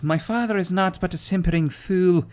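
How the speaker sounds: lightly voiced Scottish princess; Scottish woman. Gamer reading the quest giver's message in Scottish. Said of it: "apparently it's a Scottish woman".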